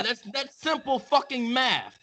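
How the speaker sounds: normal talking voice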